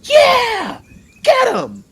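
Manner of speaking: high, loud gleeful shouting; excited cheering. Acted. Boy cheering the fight from Dunk's shoulders, excited shouting. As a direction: bounce with excitement as you yell.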